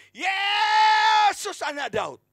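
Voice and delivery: very loud, high, long-stretched yell; shouted cry. Retold or imitated. Preacher as blind Bartimius calling to Jesus, drawn-out imitated shout. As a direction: stretch the name into a huge shout. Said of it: "Dia berteriak".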